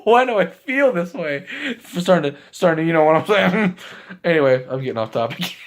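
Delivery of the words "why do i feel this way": higher, put-on bewildered voice; lovestruck Broly. Broly smitten with Chile, high bewildered voice. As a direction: play Broly confused by his crush, pitched up. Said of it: "and Broly's like".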